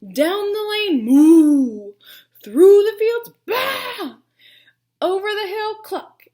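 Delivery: excited chase. A narrator's reading. Chase with animal calls.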